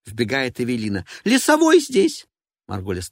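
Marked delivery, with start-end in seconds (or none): 1.2-2.3 high slow alarmed cry; a panicked woman announcing; shrill diva voice. Acted. Evelina bursts in.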